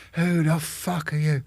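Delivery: high, sharp mock yell of a drill sergeant; imitating an angry sergeant's shout; retold shouting sergeant. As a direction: bark it at the new recruit. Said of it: "he comes shouting at me".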